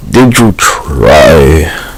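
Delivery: very slow, stretched-out did you try; drawn-out sarcastic question. Acted. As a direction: drag every word out in disbelief. Repeated mocking question.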